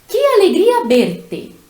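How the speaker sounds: exclaiming joy. Shown as a demonstration. Teacher showing students an exclamation, delighted exclaim. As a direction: exclaim "que alegria verte" with delight, stress on "alegria". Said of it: "estou usando o que para exclamar".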